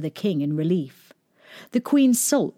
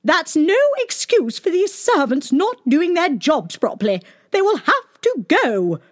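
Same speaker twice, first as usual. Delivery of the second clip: high, loud cold refusal; a cross queen passing sentence; stern angry queen; 10 semitones higher, 4 dB louder than usual. A narrator's reading. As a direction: shut the plea down.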